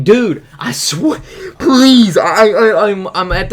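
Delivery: loud, high, stammering retold plea; exasperated pleading. Storyteller begging the man chasing him, exasperated loud pleading. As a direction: beg at the end of your patience. Retelling his plea to the pursuer.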